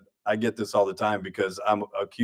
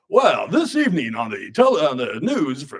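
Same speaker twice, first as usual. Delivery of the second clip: hammed-up TV newsreader, pitched up; news anchor voice; 5 semitones higher than usual. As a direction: parody a broadcaster opening the evening news. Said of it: "expecting me to do the whole".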